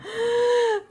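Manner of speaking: sharp high gasp of surprise through tears; tearful gasp. Real, not acted. Wife gasps on seeing the wedding ring, tearful. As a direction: catch your breath in teary shock.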